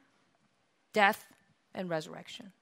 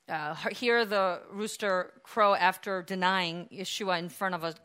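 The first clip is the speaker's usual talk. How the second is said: normal talking voice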